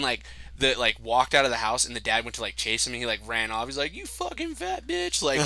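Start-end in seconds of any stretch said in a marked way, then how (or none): 3.9-5.2 mock scream. Retelling a dating show guy screaming at the mom, high mock scream. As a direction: squeal the insult like a retold tantrum. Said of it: "screaming and stuff".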